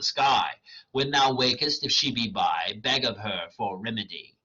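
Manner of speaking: dramatic reading of verse; reading Shakespeare aloud. Acted. Reader voicing Hermia rejecting Demetrius, dramatic. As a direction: read the lines as the character, measured. Oberon's charm read aloud.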